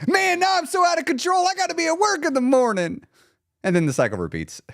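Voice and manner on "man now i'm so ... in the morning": high-pitched wail of regret; mock panic. Gamer realizing it's two in the morning, mock panic. Acted panic at staying up late.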